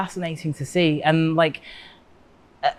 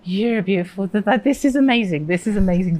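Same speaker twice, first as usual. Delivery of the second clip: retold delight; 4 semitones higher than usual. Quoting own delighted reaction to him.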